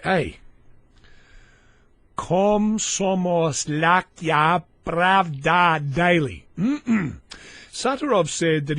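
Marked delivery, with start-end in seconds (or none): 2.2-6.5 "Komsomolskaya Pravda" very slow and loud, syllable by syllable; laboured Russian pronunciation. Pretend. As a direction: sound out "Komsomolskaya Pravda" with heavy effort on each syllable. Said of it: "he could pronounce a word like".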